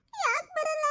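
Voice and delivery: put-on high little boy voice asking why; a small boy pleading to know why; child's plaintive question. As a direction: ask like a bewildered small child. Child asks why mother won't come.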